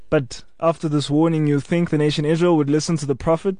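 normal talking voice

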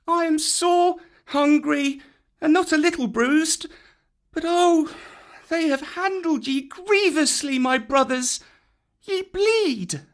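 put-on very high child voice, bruised but caring; young boy worried for his friends; boy Mowgli. A narrator's reading. Mowgli speaking.

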